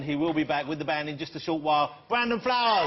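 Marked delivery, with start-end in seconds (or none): 2.1-2.9 loud, high showman's call of the name; announcer shout. Real, not acted. Host introducing Brandon to the audience, loud announcer call. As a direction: announce him to the crowd. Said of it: "Ladies and gentlemen".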